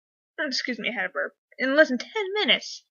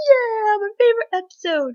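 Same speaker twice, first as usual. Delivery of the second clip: excited; 10 semitones higher, 4 dB louder than usual. Retold or imitated. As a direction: imitate someone thrilled about a favorite episode. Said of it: "He was so excited".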